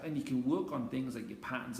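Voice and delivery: normal talking voice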